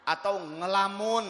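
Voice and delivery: ordinary talk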